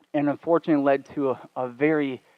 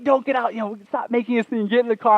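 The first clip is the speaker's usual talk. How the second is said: high, urgent imitation of girlfriend; mock scolding; 8 semitones higher, 4 dB louder than usual. Girlfriend begging him to stay in car, mock scolding. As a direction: imitate her pleading not to make a scene. Girlfriend pleads, stop making a scene.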